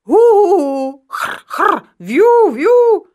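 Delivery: squawking trumpet. A narrator's reading. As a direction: blare and squeal like a broken horn. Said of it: "a troubil dál".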